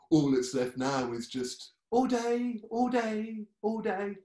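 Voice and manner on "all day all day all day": slow, then much higher sing-song; Molly's refrain. Retold or imitated. [name] imitates what is left of Molly, slow then high. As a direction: let it loop, higher each time. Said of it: "now it's all gone".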